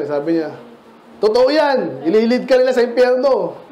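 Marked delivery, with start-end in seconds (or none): 1.2-3.6 higher, mock-angry outburst with a laugh. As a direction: imitate a furious believer, half laughing. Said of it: "Galit na galit yung Kristiyano".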